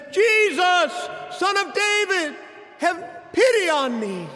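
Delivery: high loud pleading wail to Jesus; desperate cry. Retold or imitated. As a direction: cry out for mercy at full voice. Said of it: "He says".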